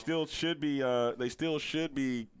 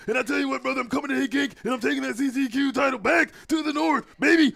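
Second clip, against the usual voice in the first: high, loud, fast hype promo; French Canadian wrestler impression; 11 semitones higher, 7 dB louder than usual. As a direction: shout it like a WWE promo, brother. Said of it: "that's the French Canadian accent".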